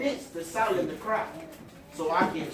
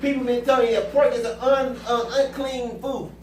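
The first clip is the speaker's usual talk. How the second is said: louder preaching build; 3 semitones higher, 4 dB louder than usual. Real, not acted. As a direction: drive the sermon home. Preaching at full force.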